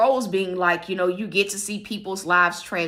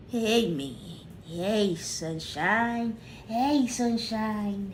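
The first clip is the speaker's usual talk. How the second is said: cooing to dog; 6 dB quieter, much slower than usual. Real, not acted.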